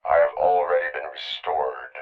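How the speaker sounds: electronically processed monotone cyborg; low, even machine voice with a synthetic edge; robotic voice. Acted. Cyborg officer confronting a criminal over a project, robotic monotone.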